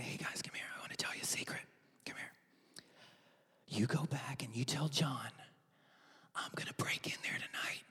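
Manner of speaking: hushed conspiratorial whisper; secret whisper. Acted. Preacher mocking a secretive Jesus, whispering. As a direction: lean in and whisper the plot. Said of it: "Nor does he do this".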